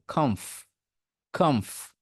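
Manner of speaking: কমফ with a puff of air on the f; airy final f. Shown as a demonstration. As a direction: end কমফ on an audible "fff". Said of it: "বাতাসটা আসবে". (কমফ-এর শেষে বাতাস ছেড়ে)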